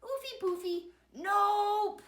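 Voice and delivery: high, loud nope; piggies refusing. A narrator's reading. The other piggies refuse soap, high and loud. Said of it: "the other piggies answered".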